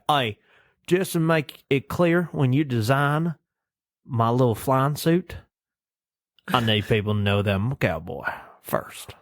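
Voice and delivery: imitated cowboy hero with Southern twang. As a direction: lay down terms in a mock Southern accent. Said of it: "best version of the Southern accent".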